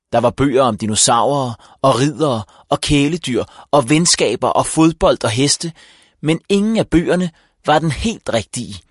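plain reading voice

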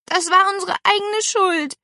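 high, upset confession; sniffling child. A narrator's reading. Niece taking the blame before her aunt, sniffling. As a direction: protest tearfully, with mild distress. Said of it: "Paula schniefte".

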